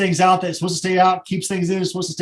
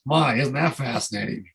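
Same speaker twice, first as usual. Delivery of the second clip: grudging, sarcastic, unimpressed; sour sarcasm; 3 semitones lower than usual. Shown as a demonstration. Tour leader showing travelers the wrong way, sour sarcasm. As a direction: say it like you're fed up with the trip. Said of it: "But don't say it like this".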